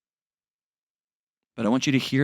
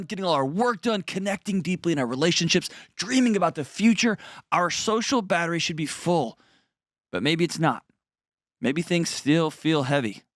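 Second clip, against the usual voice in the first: bright, higher sponsor-read voice; 5 semitones higher than usual. Acted. Host reading the BetterHelp sponsor spot as an upbeat ad. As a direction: sell it like a podcast ad, upbeat.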